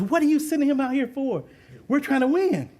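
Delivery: a fellow coach objecting, lightly acted; indignant coach. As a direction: throw it back like an annoyed coach. Other coach snaps back angrily.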